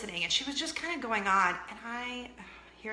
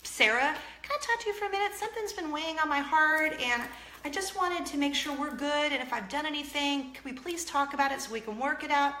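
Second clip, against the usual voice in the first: higher, sweet advice voice with a laugh; quick recovery; 6 semitones higher than usual. Retold or imitated. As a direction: cover the slip with bright motherly coaching. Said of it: "I said".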